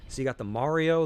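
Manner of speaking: ordinary delivery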